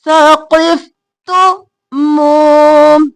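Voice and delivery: slow, drawn-out tajweed chant; Quran recitation. Shown as a demonstration. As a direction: recite it slowly, stretching the vowels. Reciting verse for students.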